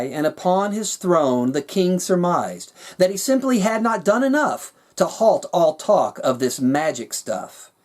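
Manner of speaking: usual speaking voice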